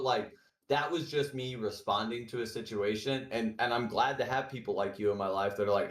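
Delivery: normal talking voice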